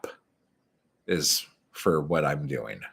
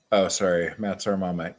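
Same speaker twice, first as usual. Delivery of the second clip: ordinary delivery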